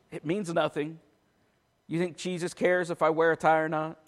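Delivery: ordinary delivery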